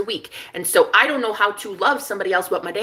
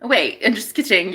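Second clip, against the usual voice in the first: normal talking voice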